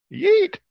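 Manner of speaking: high shrieking yeet; girly scream. Retold or imitated. Guy fleeing up a tree from a moose, high shriek. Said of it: "Scream like a girl".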